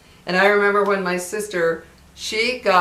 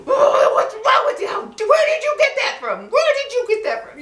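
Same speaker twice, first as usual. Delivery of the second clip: put-on high-pitched, louder shouting mother tearing into her child; furious mom shouting; 6 dB louder than usual. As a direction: scream it like a mom who cannot believe what she heard. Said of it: "she ripped into me".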